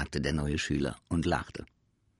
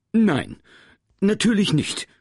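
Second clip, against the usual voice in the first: backing down; 13 semitones higher, 10 dB louder than usual. Acted. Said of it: "lenkte Herr Groll ein".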